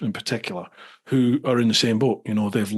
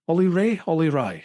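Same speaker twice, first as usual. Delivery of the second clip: normal talking voice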